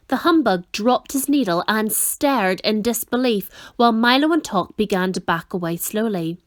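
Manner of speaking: ordinary delivery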